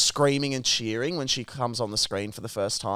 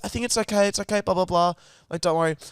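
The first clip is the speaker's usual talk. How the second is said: higher, gentle mock calming; calm them down lightly, pitched up; soothing friend; 6 semitones higher, much faster than usual. Soph calming the angry guy, gentle higher voice. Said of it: "Soph comes over and goes".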